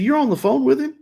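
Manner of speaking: high, accusing question, retold; acted-out astonished challenge; incredulous. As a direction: pitch it up in disbelief. Retold confrontation with wife.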